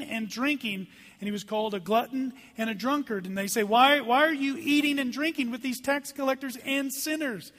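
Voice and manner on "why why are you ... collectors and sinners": raised, indignant demand; strong complaint. Acted. Pharisees challenging the disciples about sinners, complaining strongly. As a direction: push it higher and louder, affronted by the company kept. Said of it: "This was a strong complaint".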